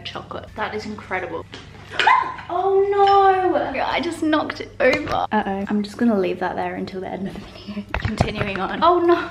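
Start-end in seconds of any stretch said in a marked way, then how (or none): 1.5-3.6 sudden gasp, high yelp of oh no; startled gasp. Real, not acted. Just knocked it over. 8.8-9.2 loud high cry of dismay; sudden realisation of a mess. Real, not acted. Squashed the tea cake.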